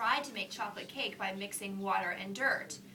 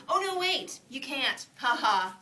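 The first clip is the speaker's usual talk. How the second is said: teasing brother; 5 semitones higher, 9 dB louder, slower than usual. Acted.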